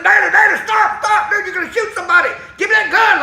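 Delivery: loud, high urgent shout; alarmed shouting. Retold or imitated. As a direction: shout to stop a panicking friend. Acting out alarmed shout at friend.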